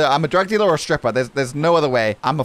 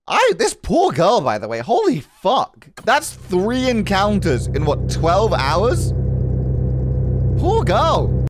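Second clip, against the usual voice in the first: shocked outburst; 8 semitones higher, slower than usual. Real, not acted. Reacting to creepy encounters.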